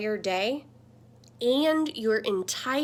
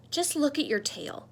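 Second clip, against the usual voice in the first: usual speaking voice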